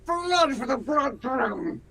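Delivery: rising to a high, frenzied kill chant; bloodthirsty shout. Acted. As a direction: roar for blood, then slow and menacing. Horn fills him with rage.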